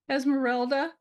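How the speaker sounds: put-on high, small young-turtle voice; little girl turtle. Little turtle gives her name.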